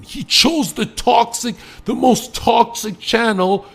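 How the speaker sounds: high, loud, slow hype-man build; hyped up. Acted. Comic boasting to his audience, high, loud and slow. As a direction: oversell it like a trailer voice.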